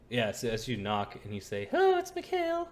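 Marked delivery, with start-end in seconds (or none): 1.7-2.7 very high, loud put-on voice; Mikhail. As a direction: pitch it absurdly high and exclaim. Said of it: "I'm not sure that's how Mikhail usually sounds".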